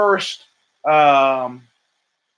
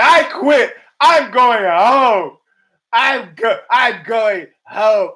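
slow, loud mocking whine of a quitter; 5 semitones higher, 6 dB louder, much slower than usual. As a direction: draw it out like a sulking kid.

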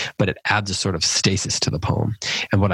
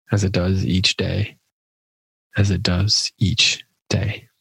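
poem reading. Acted. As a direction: let each image breathe, unhurried. Reads the whole poem aloud.

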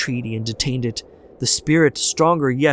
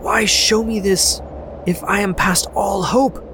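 desperate pleading; 11 semitones higher than usual. A narrator's reading. Scrooge pleading with the spirit to spare him, high desperate plea. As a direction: plead for his life, voice raised. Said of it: "he cried".

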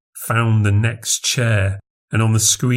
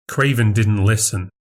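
usual speaking voice

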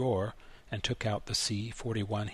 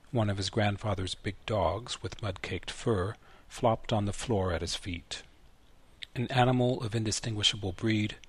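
plain reading voice

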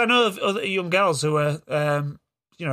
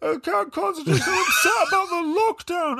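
George; 14 semitones higher than usual. Retold or imitated. Dad mimicking son dodging schoolwork, whining wail. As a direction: wail excuses like a kid putting on upset. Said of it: "doesn't want to do his schoolwork".

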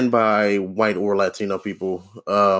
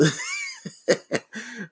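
high, soft real laughter; high breathy laugh; 7 dB quieter than usual. Real, not acted. Comedian laughing at being told to be happy, high soft laugh. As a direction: laugh helplessly, high and quiet.

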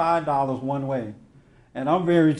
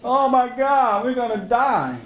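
panicked scream; 7 semitones higher than usual. Retold or imitated. Disciples in the storm waking Jesus, panicked yelling. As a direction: yell for Jesus like the boat is sinking. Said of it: "they started screaming".

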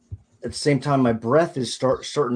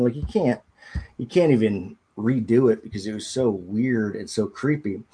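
normal talking voice

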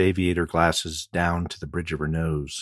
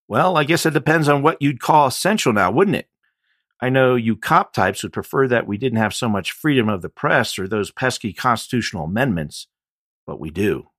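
higher, louder wisecracking jab; smirking retort; 7 semitones higher, 8 dB louder than usual. A narrator's reading. Chance smirking back at the deputy, cocky. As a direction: needle the cop with a smirk.

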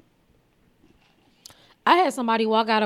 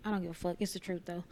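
muttered aside; 4 semitones lower, 15 dB quieter, faster than usual. Real, not acted.

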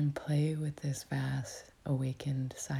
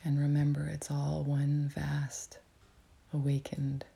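whispering. Real, not acted. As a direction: whisper it gently, like guiding a meditation. Whispers throughout to guide meditation.

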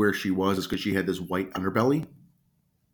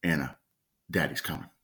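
retold yell, said softly; 4 semitones lower, 6 dB quieter than usual. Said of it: "yelling her name the entire time".

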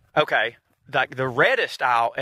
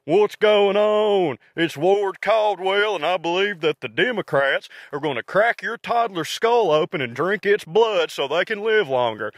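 exaggerated Southern character voice, big stretched-out greeting; Ward Caldwell; 4 semitones higher than usual. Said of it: "when I play Ward Caldwell".

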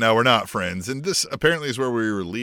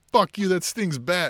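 booing fans; 7 semitones higher, slower than usual. Retold or imitated. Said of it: "we'll all boo him and be like".

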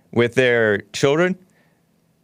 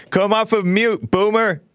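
high, teasing jab; 8 semitones higher than usual. Acted. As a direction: tease the caller playfully. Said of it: "I say that lovingly".

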